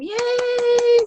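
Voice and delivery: high, loud cheering whoop; excited whoop. Real, not acted. Host cheering the top seller on the call, high excited whoop. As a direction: cheer her on like she's in the room.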